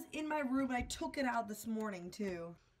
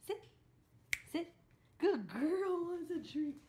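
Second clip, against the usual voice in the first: talking to a dog; 5 semitones higher, 3 dB quieter than usual. Real, not acted.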